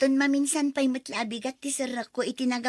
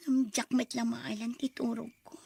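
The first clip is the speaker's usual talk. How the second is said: normal talking voice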